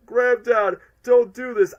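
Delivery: high, loud plea; pleading cry. Retold or imitated. Fan reliving the movie scene, loud pleading cry. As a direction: beg him not to do it.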